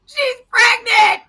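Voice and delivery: slow, high wailing shout; shouting the news to husband, slow and high; distraught shout. Retold or imitated. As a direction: wail it out loud and slow. First telling.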